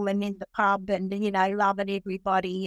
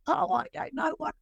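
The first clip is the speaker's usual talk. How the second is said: high, soft, tearful imitation; mock bawling; 6 semitones higher, 4 dB quieter than usual. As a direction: play at helpless blubbering, voice pitched up. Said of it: "I'm bawling".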